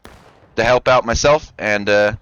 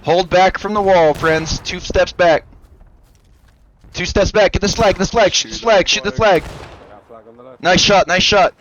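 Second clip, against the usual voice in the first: shouting orders over battle; 7 semitones higher than usual. Real, not acted.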